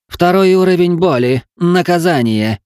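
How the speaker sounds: high, loud demoness battle cry; shouted. Acted. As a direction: bellow the command, dragging out the last word. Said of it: "выкрикнула демонесса".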